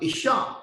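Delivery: Isha said ee-SHAH, rising; pronounced Isha. Shown as a demonstration. Teacher gives the Hebrew word for woman, ee-shah. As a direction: say Isha with a lift at the end. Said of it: "It pronounced".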